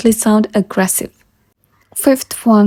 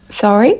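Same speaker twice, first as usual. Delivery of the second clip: "sorry" asked with the pitch climbing at the end; rising question on sorry. Shown as a demonstration. Teacher correcting an apologetic take, questioning rise on "sorry". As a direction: lift the last syllable of "sorry" into a question. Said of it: "so ask".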